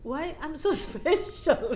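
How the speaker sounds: slow, chuckling mock question; asking God with amused wonder; laughing disbelief. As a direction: laugh through the word special.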